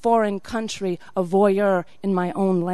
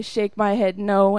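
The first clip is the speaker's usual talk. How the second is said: ordinary delivery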